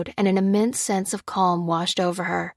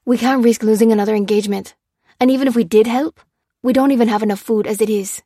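fast, louder and higher, clipped with irritation; snapping; 3 semitones higher, 6 dB louder, faster than usual. A narrator's reading. Lopez snapping at Lucy for wanting to help, fast and loud. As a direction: bite the words out fast at her. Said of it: "snapped Lopez".

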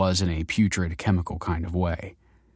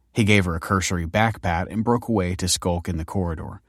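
ordinary delivery, nothing marked

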